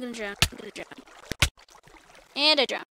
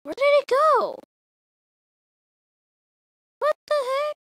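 very high, loud squeal of dismay; shocked disbelief; 13 semitones higher, 7 dB louder than usual. Real, not acted. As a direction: squeal in alarm at a sudden loss. Lost items in game.

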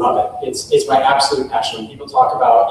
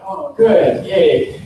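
mock enthusiasm, much higher, then falling; unimpressed cheer; 6 semitones higher, 4 dB louder, much slower than usual. Acquaintances reacting to a high school band, sarcastic. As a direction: fake a polite cheer you don't mean. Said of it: "it's like".

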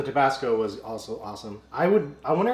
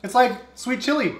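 surprised; 10 semitones higher, 5 dB louder than usual. Real, not acted. Surprised at the taste.

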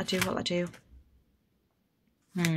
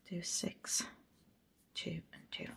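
whispered counting; 2 semitones lower, 16 dB quieter than usual. Real, not acted. Counting under breath.

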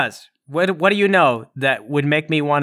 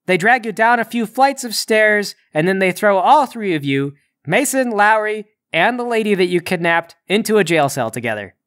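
urgent, raised game master voice; dramatic scene narration; 5 semitones higher, 4 dB louder than usual. A narrator's reading. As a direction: narrate the capture with driving energy.

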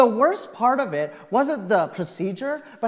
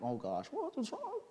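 softer, anxious question; worried hush; 5 semitones higher, 14 dB quieter than usual. Retold or imitated. Patient asking the nurse what's wrong, softly worried. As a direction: ask fearfully under your breath.

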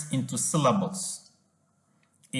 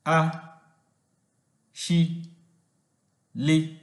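"a", "shi", "li" each said apart, higher; syllable by syllable; 3 semitones higher, slower than usual. Shown as a demonstration. Teacher breaks ashili into syllables, slow and separate. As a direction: sound out each syllable with a pause. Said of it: "that is".

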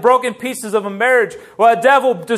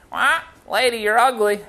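parrot voice. Acted. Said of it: "and the parrot would go".

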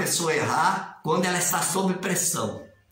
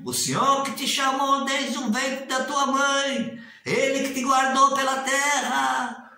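ordination prayer; 6 semitones higher than usual. Retold or imitated. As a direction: intone it like an elder ordaining. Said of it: "É assim".

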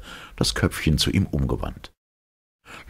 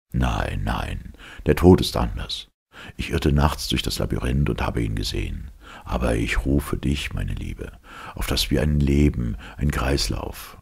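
earnest man's voice; 2 semitones lower than usual. Acted. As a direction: urge her gently but firmly. Lossi's long appeal. (ernste, tiefere Männerstimme)

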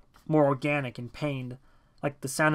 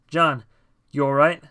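raised voice, checking on someone; calling to friend; 8 dB louder than usual. A narrator's reading. Dave to John ten feet away, calling out. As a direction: call over to a dazed friend, a little loudly. Narrator calls to dazed friend.

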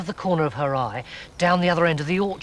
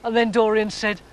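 plain reading voice

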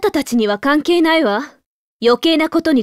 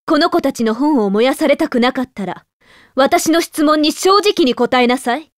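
ordinary talk, nothing marked

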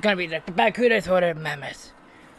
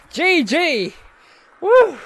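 ordinary delivery

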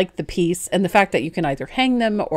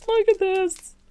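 higher, delighted repeat; excited; 14 semitones higher, slower than usual. Real, not acted. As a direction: squeal it out in delight. Showing off new craft pieces.

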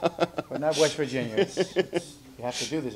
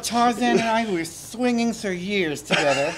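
higher, slow campy diva voice; 7 semitones higher, 5 dB louder, slower than usual. Pretend. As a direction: purr it like an aging starlet. Diva puppet persona.

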